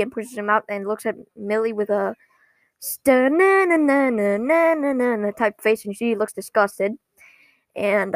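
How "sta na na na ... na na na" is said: slow imitated "na-na" noise; comic sound for a facial expression; drawn-out nonsense vocalizing. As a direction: stretch out a silly wordless sound. Imitates a sleazy look with sound.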